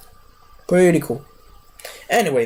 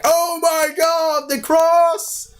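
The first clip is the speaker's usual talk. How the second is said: much higher panicked cry; mock panic; 13 semitones higher, much slower than usual. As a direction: squeal like the crowd fleeing. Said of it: "they're like".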